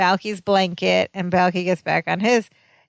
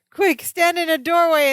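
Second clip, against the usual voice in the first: mock panic; 8 semitones higher than usual. Acting out Balki's earthquake panic.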